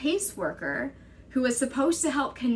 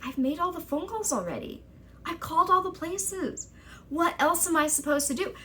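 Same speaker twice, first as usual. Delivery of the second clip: higher, exasperated protest; frustrated exasperation; 7 semitones higher than usual. Retold or imitated. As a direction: push back in disbelief. Frustrated reply to caseworker.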